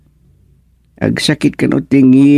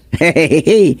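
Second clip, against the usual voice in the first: laugh; 5 semitones higher than usual. Acted. Joking about selling coffins.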